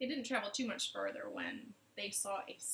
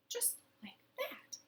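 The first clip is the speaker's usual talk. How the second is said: whisper; 12 semitones higher, 8 dB quieter than usual. A narrator's reading. Storyteller whispers for effect.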